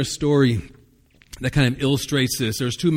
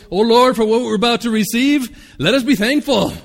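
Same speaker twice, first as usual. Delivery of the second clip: high, loud rushed table grace; loud recited grace; 8 semitones higher, 7 dB louder than usual. Acted. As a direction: blurt out the mealtime prayer in panic. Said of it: "the one that father ... used to repeat".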